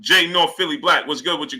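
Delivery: normal talking voice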